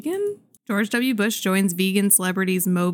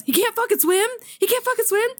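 much higher, mock exasperated yelling; exasperated shout; 13 semitones higher, faster than usual. Retelling a movie bystander yelling, high and exasperated. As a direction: squeal it like an incredulous bystander. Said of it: "this other guy looks and he goes".

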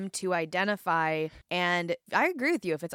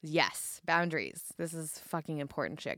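ordinary delivery

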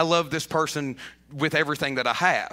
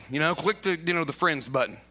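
normal talking voice

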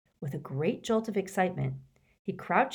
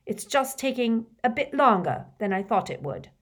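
much louder, higher, bragging defiance; defiant angry teen; 3 semitones higher, 6 dB louder than usual. Acted. Malfoy rejects Snape's protection, loud and defiant. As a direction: throw his help back at him.

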